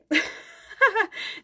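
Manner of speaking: short high giggle; high laugh. Real, not acted. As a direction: laugh it off brightly. Laughing about tired eyes.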